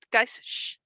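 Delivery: ordinary talk, nothing marked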